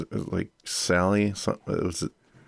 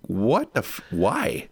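baffled, pitch leaping up on why; high-pitched bewilderment; incredulous; 6 semitones higher, much slower than usual. Real, not acted. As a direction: cut off the curse, then squeak out the question. Baffled by director's odd film choice.

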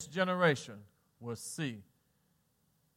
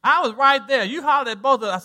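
loud, raised-pitch imitation of an accuser; mock indignant yell; 5 semitones higher, 8 dB louder than usual. Family member insisting he hollered, mock indignant yell. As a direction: insist loudly, as a retold witness.

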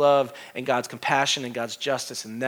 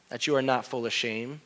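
usual speaking voice

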